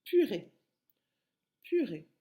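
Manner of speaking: "purée" with a popped, loud first syllable; punched initial P. Shown as a demonstration. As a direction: hit the p of "purée" hard. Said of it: "Par quel son commence".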